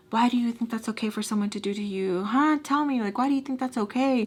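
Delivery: fast, high interrogating; pissed. Retold or imitated. Angry man demanding answers from her, indignant. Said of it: "So he's pissed".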